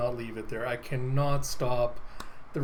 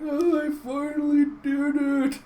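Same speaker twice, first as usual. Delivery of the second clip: tearful; 12 semitones higher, 3 dB louder than usual. Acted. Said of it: "And Teagle's just crying".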